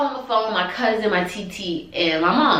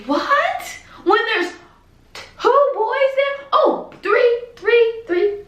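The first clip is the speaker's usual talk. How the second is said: much higher, stunned disbelief; outraged disbelief; 13 semitones higher, much slower than usual. Real, not acted. Reacting to the brother losing mom, in much higher disbelief. Outraged that the boys lost her.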